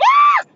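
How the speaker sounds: high piercing shriek; frozen scream. Retold or imitated. Frozen at the bar, screaming at the man, piercing shriek. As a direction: freeze and shriek. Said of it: "I froze and I was just like".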